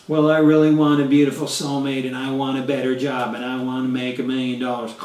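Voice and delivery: low, droning monotone with a sigh; mock a listless wimp, drained of all energy; limp, lifeless drone, sinking low; apathetic. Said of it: "you're not going to get it in life being apathetic".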